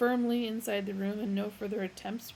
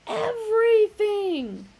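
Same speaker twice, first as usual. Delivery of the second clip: very loud, much higher, slow; triumphant joint cry; in chorus; 13 semitones higher, 12 dB louder, slower than usual. A narrator's reading. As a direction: proclaim it together. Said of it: "they said in chorus".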